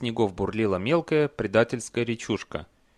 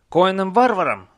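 louder, higher troll question; incredulous challenge; 9 semitones higher, 9 dB louder than usual. A narrator's reading. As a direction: throw the name back in disbelief. (громко, с недоверием)

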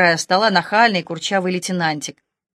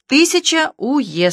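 dictating cop; 8 semitones higher, 3 dB louder than usual. A narrator's reading. Operative dictates the sum.

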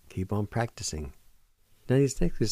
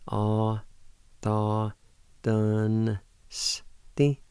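syllable-by-syllable pronunciation; much slower than usual. Shown as a demonstration. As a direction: isolate each syllable clearly. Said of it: "pronounce the N sound with the D V like this".

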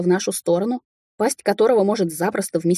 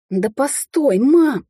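rising to a loud, high cry; exasperated son's exclamation; exclaiming; 7 semitones higher, 4 dB louder, much slower than usual. A narrator's reading. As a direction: call out to stop someone. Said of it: "воскликнул Кай".

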